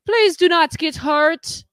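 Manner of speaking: hammed-up imploring; mock pleading. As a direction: beg him in exaggerated concern. Officials imploring the fighter.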